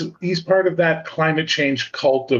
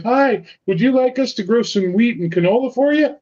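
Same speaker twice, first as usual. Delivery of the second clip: high, overly polite offer; a province sucking up to a new friend; eager Saskatchewan; 9 semitones higher than usual. Retold or imitated. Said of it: "Saskatchewan will be like".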